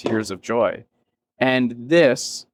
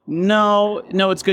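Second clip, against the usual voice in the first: high protest; 10 semitones higher, slower than usual. Retold or imitated. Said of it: "I was like".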